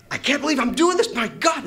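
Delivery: high, loud joyful disbelief; ecstatic. Retold or imitated. Speeding downhill, high ecstatic shout. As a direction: cry out in pure thrill. Exhilarated skiing.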